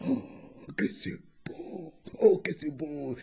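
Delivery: hushed, savouring murmur; hushed delight. Acted. Hyena tastes the hare's food, hushed delight. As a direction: savour it almost in a whisper.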